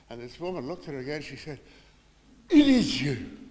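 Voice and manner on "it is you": loud, high exclamation of recognition; startled loud "it is you"; surprised recognition. Retold or imitated. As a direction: blurt it out as if you've just placed her. Woman recognising Mum, retold.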